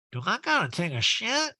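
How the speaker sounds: high-pitched imagined inner thought; Erica. Retold or imitated. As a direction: mimic someone lost in her own head. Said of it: "truly it was just like".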